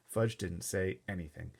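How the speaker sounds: usual speaking voice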